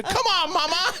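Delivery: put-on high, laughing child complaint; whiny toddler. Daughter scolds her mother over broken cup, high whine.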